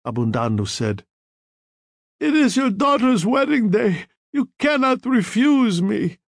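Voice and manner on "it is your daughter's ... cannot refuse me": high, pleading dying man's demand; feverish, high-pitched appeal; desperate insistence. A narrator's reading. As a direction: insist as if your life hangs on it. Dying man demands a favour.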